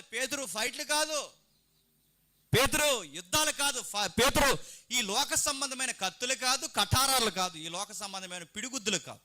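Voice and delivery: high, urgent preacher's admonition; impassioned admonishing. Acted. Preacher admonishing Peter against fights, impassioned. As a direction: raise the pitch and hammer each negation.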